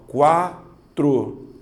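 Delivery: quatro drawn out slowly and loudly with the U sounded, KWA-tro; slow demo word. Shown as a demonstration. Teacher showing the class the word quatro, slow and loud. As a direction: stretch quatro so the W is clearly heard. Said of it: "olha na de baixo".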